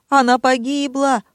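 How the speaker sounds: high-pitched lament; overwrought wail. A narrator's reading. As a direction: wail it like a tragedy. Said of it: "надрывался Тоша". (надрывный вопль)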